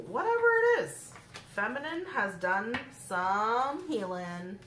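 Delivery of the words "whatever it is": excited. Real, not acted. Sudden high outburst after quote.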